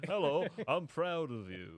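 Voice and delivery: slow drawled southern voice. Retold or imitated. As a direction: drawl it out like a proud dad. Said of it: "do you sound so southern".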